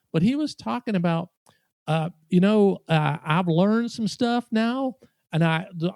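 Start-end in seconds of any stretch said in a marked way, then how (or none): 2.9-5.9 put-on loud Southern accent. Loud diner telling friends his news in a Southern accent. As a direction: imitate a Southern diner holding forth. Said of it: "talking so loud in this Southern accent".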